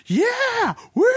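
high mock cheer at a celebrity; excited fan cheer. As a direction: whoop like a starstruck fan. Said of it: "And I'm screaming".

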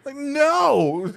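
high, loud, drawn-out no; hammed-up squeal of protest; incredulous refusal. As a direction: refuse in comic disbelief, pitch way up. Acting out his refusal.

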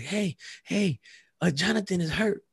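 high-pitched imitation of a worried kid; child raising alarm. Boy telling an adult his twin is hurt, alarmed. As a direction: call out like a boy bringing bad news. Voices child running up with news.

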